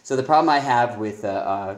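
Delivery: ordinary talk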